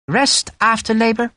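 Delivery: high, sugary Irish suggestion; sweetly. A narrator's reading. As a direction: offer it with false sweetness. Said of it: "Hogan suggested sweetly".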